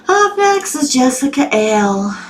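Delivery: high, drawn-out Southern twang; southern accent. Shown as a demonstration. Host announcing who is up next, southern accent. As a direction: stretch it out slowly in a Southern accent. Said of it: "that's my southern accent".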